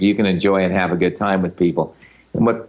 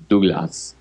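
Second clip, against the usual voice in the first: Douglas said in imitation of her French accent; imitated French accent. Host mimics the interviewer saying his name, French accent. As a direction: mimic a French "Doo-glas". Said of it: "the way you say my name".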